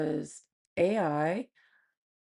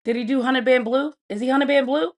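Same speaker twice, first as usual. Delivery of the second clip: incredulous; 8 semitones higher, 8 dB louder than usual. Real, not acted. Incredulous questions.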